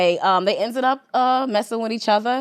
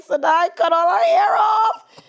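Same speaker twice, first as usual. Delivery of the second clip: retold crying; 10 semitones higher than usual. Calling her husband about the daughter's haircut, tearful. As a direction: cry it out to Dwayne, retold. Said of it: "she see me crying".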